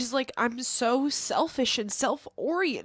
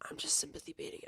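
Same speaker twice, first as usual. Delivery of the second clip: whispered to herself, much softer; 12 dB quieter, faster than usual. Real, not acted. She whispers the line to herself after the argument. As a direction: breathe it out with no voice. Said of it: "repeats same words voiced then whispered".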